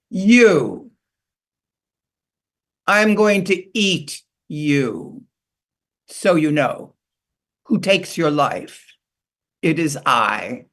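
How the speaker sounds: a proud eagle announcing the kill; booming eagle. Acted. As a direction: boast down at her like a mighty eagle. Eagle threatens the beaver.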